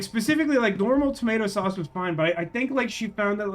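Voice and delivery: usual speaking voice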